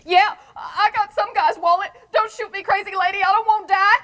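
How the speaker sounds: high, loud blubbering plea; kid crying. Retold or imitated. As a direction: beg like a terrified kid half in tears. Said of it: "he starts to crying and says".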